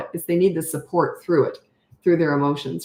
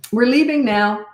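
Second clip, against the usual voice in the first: firm parent; 6 semitones higher, 5 dB louder than usual. Pretend.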